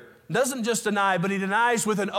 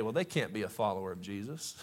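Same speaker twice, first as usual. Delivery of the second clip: hushed onlooker; 10 semitones lower, 9 dB quieter than usual. Retold or imitated. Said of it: "people who have been hearing you talk say".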